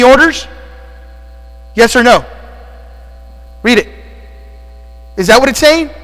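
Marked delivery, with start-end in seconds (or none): none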